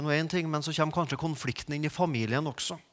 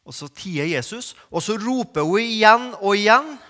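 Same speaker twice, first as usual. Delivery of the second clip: loud, high-pitched sermon climax; full-force preaching; 4 semitones higher, 8 dB louder than usual. Acted.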